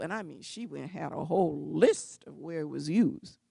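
ordinary talk

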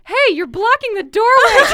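loud, high mock shout at someone in the doorway; Jonathan's annoyed line. Friend retells a book scene, mock yelling as Jonathan. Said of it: "you hear Jonathan go".

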